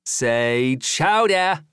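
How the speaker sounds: put-on slow stretched New England accent. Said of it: "practicing his New England accent".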